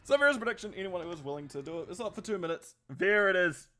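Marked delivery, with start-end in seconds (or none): none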